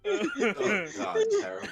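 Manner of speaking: very high, breaking with laughter; squeaky laugh-cry. Real, not acted. Crying with laughter.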